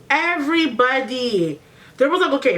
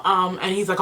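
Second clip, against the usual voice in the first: ordinary talk, nothing marked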